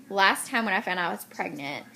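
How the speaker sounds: ordinary delivery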